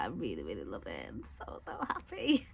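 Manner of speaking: soft whispered delight; whispering. Real, not acted. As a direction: hush it like a secret. Gushing over her purchase.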